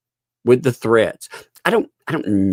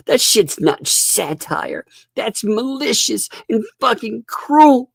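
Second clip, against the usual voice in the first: high, drawn-out mock indignation; hammed-up whine, swooping upward in outrage; mock whining; 11 semitones higher than usual. Imitating them complaining he's no Jimmy Kimmel, mock whining. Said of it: "they're whining".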